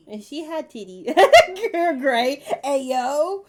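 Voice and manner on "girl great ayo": high, loud amused cry; excited laughter. Real, not acted. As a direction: whoop with laughter. Amused outburst.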